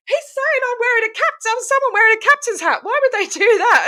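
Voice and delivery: high-pitched, giggly mock disbelief; amused incredulity. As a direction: relay the absurd accusation in a squeal of amusement. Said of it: "it was just so funny".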